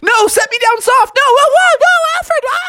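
very high, rising panicked yell. Acted. Froggy dropped by Alfred toward his house, high panicked yell. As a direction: scream as you're dropped.

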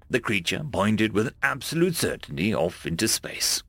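ordinary delivery, nothing marked

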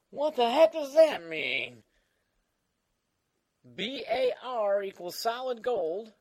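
put-on high, slow cranky old man; creaky old-timer voice; Old Man Dan. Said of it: "Well, Old Man Dan".

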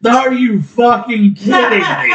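slow, high-pitched indignant disbelief; real exasperated disbelief; incredulous outrage. Real, not acted. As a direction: stretch it out, appalled at the very question. Outraged answer about asking his wife.

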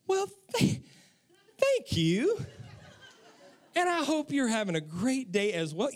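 sudden sweet politeness. Retold or imitated. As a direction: flip the switch to charming for the cashier. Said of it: "I flip a switch".

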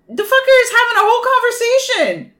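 high-pitched exasperated disbelief; incredulous outburst. Acted. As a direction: voice jumps way up in mock outrage. Incredulous rant about the plot.

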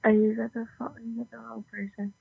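usual speaking voice

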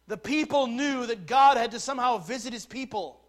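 loud, high, insistent sermon delivery; forceful preaching. Real, not acted. Preacher explains what believers should have known, forceful preaching. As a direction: press the point on the congregation loudly.

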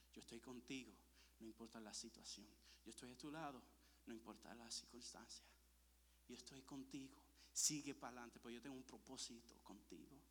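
low, soft voice of God; calm reassurance. Retold or imitated. God comforting the faithful son, calm reassurance. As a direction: speak low and softly, reassuring.

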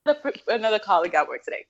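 ordinary delivery

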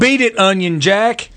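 high, slow barked warning; shouting at trespasser. Pretend. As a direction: chase a kid off the porch. Grumpy man bit.

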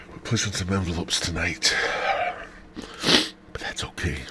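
conspiratorial whisper. Real, not acted. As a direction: breathe it out as a secret. Whispered aside.

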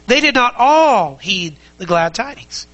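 slowed down, all held with rising tune; weight on all, unhurried; stress on ALL. Shown as a demonstration. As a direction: underline all as you read it. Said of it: "underline that word all".

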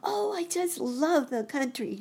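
put-on high, gushing child voice; delighted boy rabbit. A narrator's reading. Boy rabbit thrilled about the country, high delighted child voice. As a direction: gush in a high little-boy voice.

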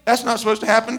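ordinary talk

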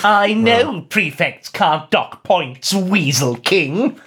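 high, very slow sneer. Acted. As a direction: sneer it with contempt. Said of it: "sneered Malfoy".

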